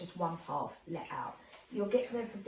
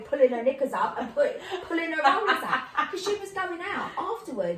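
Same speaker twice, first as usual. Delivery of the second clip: loud, high, giggly storytelling; tickled by the absurd picture; laughing while telling; 6 semitones higher, 7 dB louder than usual. Real, not acted. Laughing at image of rollers.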